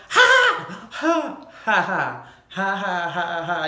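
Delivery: big loud cackling laugh; loud burst of laughter. Real, not acted.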